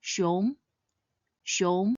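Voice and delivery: "Xiong" with a rising second tone. Shown as a demonstration. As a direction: lift "Xiong" upward like a question. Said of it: "pronounced in the second tone".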